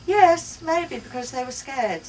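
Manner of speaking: eager mother agreeing; eager yes. Acted. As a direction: jump on her answer eagerly. Mother answering child eagerly.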